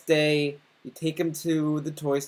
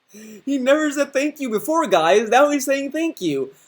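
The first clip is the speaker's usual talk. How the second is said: laughing mockery; 9 semitones higher, 4 dB louder than usual. Real, not acted. Teasing co-host, laughing.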